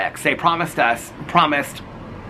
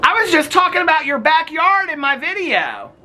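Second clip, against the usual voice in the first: calling across. Real, not acted. Talking to neighbor across yard.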